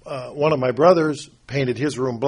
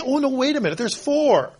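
much higher, a sudden playful "oh no, wait"; mock realization; 10 semitones higher than usual. Preacher voicing the proverb as it adds one more, mock surprise. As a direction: catch yourself like you just noticed one more.

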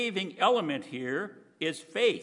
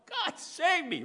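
cry for help; 12 semitones higher, slower than usual. Acted. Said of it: "he cried out".

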